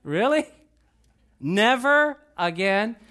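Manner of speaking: incredulous, rising mock questions; teasing, drawn-out skepticism; amused disbelief. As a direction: question the promise as an amused God. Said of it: "God starts laughing".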